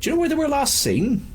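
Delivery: high West Country question, rising; West Country accent. Acted. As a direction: pitch the West Country thug right up. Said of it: "I've just been doing West Country".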